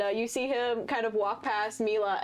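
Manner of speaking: ordinary talk, nothing marked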